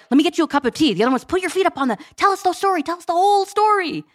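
high-pitched imitation of excited kids; eager children. Children eagerly looking after mom, retold.

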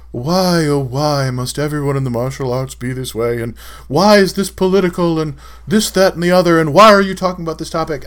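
hammed-up imitation of a complaining troll; mock lament. Mimics complaining trolls' philosophical questions.

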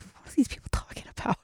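whispered, quick and breathless; mock whisper. Modern people hearing old English, in a quick mock whisper. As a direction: hush it like a baffled onlooker. Confused colonial-era English speakers.

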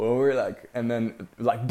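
ordinary delivery

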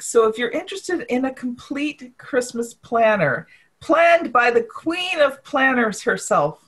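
upbeat commercial announcer voice. Acted. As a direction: sell it like a TV ad. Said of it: "yes, I'm using my commercial voice".

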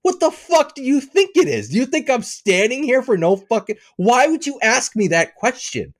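high-pitched, ranting incredulity; irritated rant. Retold or imitated. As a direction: rant it as if at the end of your patience. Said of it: "I was so irritated".

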